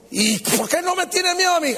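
high-pitched, put-out devil challenge; acted indignant question, pitched well up. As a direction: play a devil baffled at not being feared. Devil challenges a fearless man.

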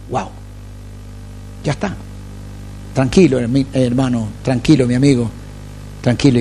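normal talking voice

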